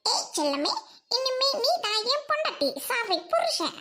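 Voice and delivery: ordinary talk, nothing marked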